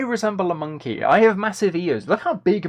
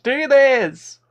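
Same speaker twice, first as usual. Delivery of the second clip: bossy demanding voice; 10 semitones higher, 7 dB louder than usual. Retold or imitated. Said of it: "being like".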